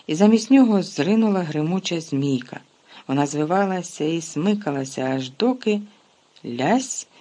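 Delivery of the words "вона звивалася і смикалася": ordinary delivery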